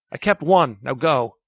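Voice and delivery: loud firm order; urgent command. A narrator's reading. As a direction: push them to leave. Doomed man orders them off.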